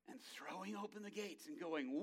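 ordinary talk

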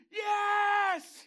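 high, loud joyful shout; heavenly host yelling yes; cheering crowd. Acted.

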